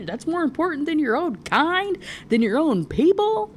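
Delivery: high, incredulous questioning; indignant disbelief. Acted. Gargoyles challenging Merrick over his own people, incredulous. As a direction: swoop up high on the affronted question.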